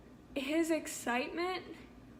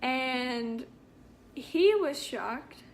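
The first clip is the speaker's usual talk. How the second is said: ordinary delivery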